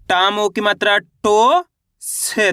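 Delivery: very slow, syllable by syllable; teacher sounding out टो slowly. Shown as a demonstration. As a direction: spell out टो deliberately for kids. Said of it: "मेरे तरीके पे गौर करना है".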